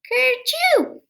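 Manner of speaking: loud, high mock sneeze "kerchoo"; big sneeze. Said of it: "I would sneeze".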